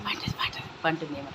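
whisper. Real, not acted. Mother whispers that her baby seems asleep. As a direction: whisper it so she stays asleep.

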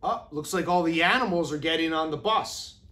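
plain reading voice

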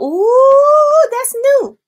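high, loud delighted ooh; light up at the news; excited surprise. Real, not acted. Reacting to her friend's new sticker subscription, high and delighted.

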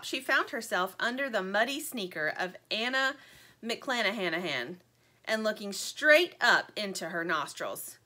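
plain reading voice

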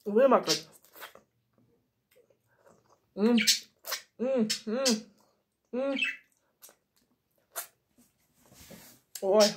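chewing between words; talking while eating; mouth full of cookie. Real, not acted. Seen 1: Repeatedly puts cookie pieces in mouth and chews while speaking.

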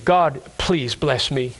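low, slow, humble prayer; subdued, reverent request; humble plea. Acted. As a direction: ask humbly, dropping the pitch and taking time. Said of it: "in humility".

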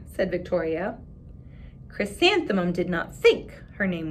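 put-on expressive read-aloud for children; story narration. A narrator's reading. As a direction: read the picture book to the class with voices. Reads picture book aloud.

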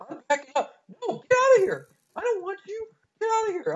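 high, loud, near-crying shriek; panicked screaming. Real, not acted. Begging attackers to leave him alone, screaming. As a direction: scream them away in real panic. Fleeing attackers on crowded island.

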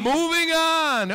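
slow, high, stretched echo; drawn-out high echo. Pretend. Host echoes the psychic.

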